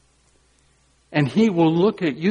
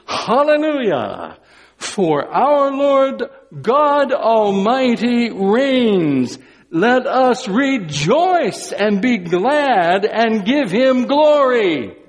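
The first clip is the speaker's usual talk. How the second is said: higher, louder, slow proclamation; proclaiming; 8 semitones higher, 4 dB louder, slower than usual. Acted. Preacher reading the heavenly multitude's shout, slow and loud. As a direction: boom it like a great multitude.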